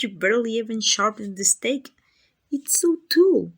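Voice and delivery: loud, high, scornful disbelief; incredulous mockery. Vampire ridicules hunter's wooden stake, incredulous mockery.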